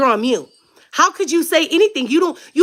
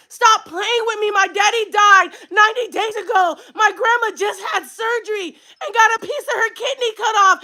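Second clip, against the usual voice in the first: real high-pitched angry outburst; upset yelling; 5 semitones higher than usual. Real, not acted. Telling off a relative over family hardships, upset yelling. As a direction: yell it, fed up and hurt. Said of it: "Sorry for yelling".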